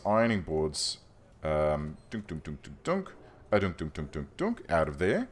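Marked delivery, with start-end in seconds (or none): 2.1-4.6 playful dunk noises. Pretend. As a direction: voice the boards popping away like a cartoon. Mimics objects being moved.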